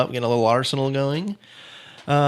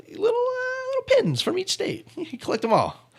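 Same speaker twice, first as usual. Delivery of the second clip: very high, silly little voice; squeaky collector voice. Acted. Host voicing quiz option B, squeaky collector voice. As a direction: pipe it up like a tiny keen collector.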